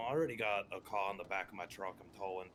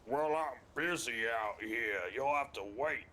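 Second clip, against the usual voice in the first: quoting the officer; 3 dB louder, slower than usual. Retold or imitated. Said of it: "And he was like".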